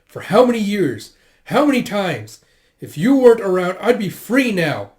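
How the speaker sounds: high, loud, slowing for emphasis; angry outburst. Acted. Chained Jeha blames his predecessor, angry outburst. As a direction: hurl the blame at him.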